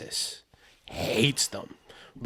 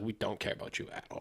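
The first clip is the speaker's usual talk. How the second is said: whispered dismissal; 3 semitones lower, 13 dB quieter than usual. Retold or imitated. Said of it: "Texas is like".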